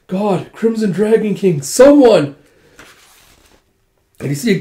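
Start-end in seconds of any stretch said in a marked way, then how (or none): 0.1-2.4 slow high appeal swelling to a loud cry; desperate plea. Retold or imitated. Surrounded lord to his god, desperate cry. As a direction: call desperately for any help.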